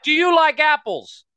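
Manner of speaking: slow, high yell through glass; imitated window shout. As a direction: shout each word to be heard through a window. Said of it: "He literally bangs on the window".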